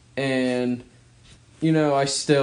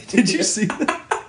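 laughing; 9 semitones higher, slower than usual. Real, not acted. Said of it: "both laughing".

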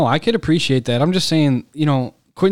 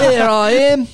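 high-pitched caricature Australian accent; 9 semitones higher, 4 dB louder than usual. Shown as a demonstration. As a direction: ham up an Aussie accent, high in the voice. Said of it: "Do an Australian accent".

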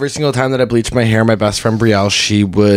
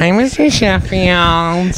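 Fran Drescher impression; 7 semitones higher, much slower than usual. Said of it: "She has super nasally".

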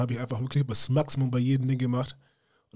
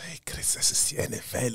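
doubting whisper; 5 dB quieter than usual. Retold or imitated. Retold doubt voiced to Chris.

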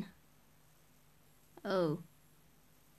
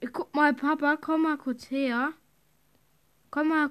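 loud, high call to dad; yelling across the room; 5 semitones higher, 8 dB louder than usual. Real, not acted. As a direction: call him over from afar. Calling dad over.

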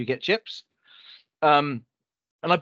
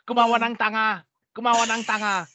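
mock angry wife; 7 semitones higher than usual. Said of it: "'cause she was so angry".

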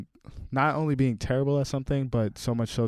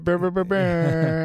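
silly trilled brr; 8 semitones higher, 4 dB louder, slower than usual. Pretend. Silly noise at portrait.

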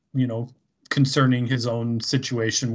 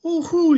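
high excited mock woohoo; a gleeful falsetto whoop at the photo; 5 dB louder than usual. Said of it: "he's like".

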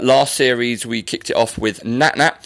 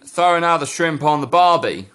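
put-on Australian accent on a catchphrase; 2 semitones higher than usual. Host quizzing Australian guest on a cliché, mock Australian accent. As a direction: try the stock Aussie accent. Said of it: "You don't say".

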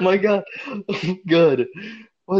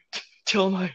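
hammed-up last words, higher and halting; dying; 5 semitones higher than usual. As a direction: stammer out dying words, dragging them.

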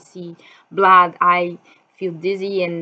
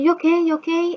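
mother asking gently; 9 semitones higher, faster than usual. Retold or imitated. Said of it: "she said".

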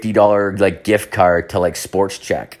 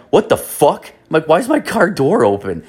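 shocked; 7 semitones higher than usual. Retold or imitated. Finding car door wide open.

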